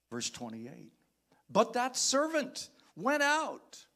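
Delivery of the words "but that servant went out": high, slow, loud preacher's telling; rising preacher's cadence; preaching emphasis. Acted. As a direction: drive the story home from the pulpit. Preaching at full force.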